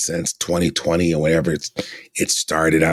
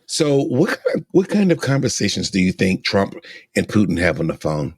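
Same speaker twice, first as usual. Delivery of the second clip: ordinary talk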